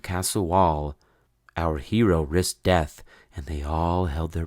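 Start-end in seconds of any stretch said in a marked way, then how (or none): none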